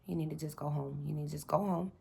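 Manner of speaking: quiet, low self-talk; hushed inner voice. Retold or imitated. Shaken after the stop, telling herself quietly to go home. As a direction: tell yourself softly to get home.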